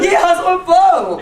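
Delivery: high, loud mock affirmation; hammed-up woman yelling; loud stranger. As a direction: shout it back. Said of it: "She's like".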